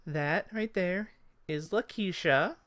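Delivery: high elf voice, rising on the name; high elf hostess; Esmeralda the elf. Acted. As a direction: present her in a high-pitched voice. Elf introduces the women.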